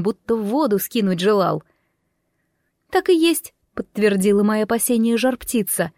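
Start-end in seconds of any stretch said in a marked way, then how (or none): 2.9-3.5 high-pitched firebird voice; high, even creature voice. A narrator's reading. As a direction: speak high as a magic bird. Firebird speaks in a story.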